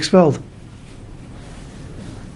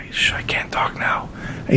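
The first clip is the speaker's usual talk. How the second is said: whispering; 10 dB quieter than usual. Retold or imitated. Man on a dark bus whispering into his phone. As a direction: hush it into the phone. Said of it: "and he starts whispering".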